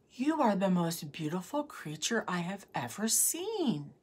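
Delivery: soft, breathy near-whisper; awed hushed whisper. A narrator's reading. Prince to Rosamond on first seeing her, awed whisper.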